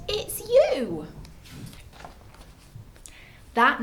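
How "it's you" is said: high, delighted reveal; amused exclamation. A narrator's reading. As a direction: tease a friend with the answer. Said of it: "they laughed and said".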